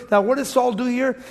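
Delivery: normal talking voice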